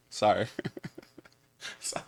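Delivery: ordinary delivery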